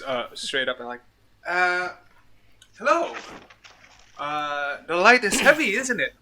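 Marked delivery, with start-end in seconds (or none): 1.5-6.1 elf woman voice. Acted. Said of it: "She just goes at it".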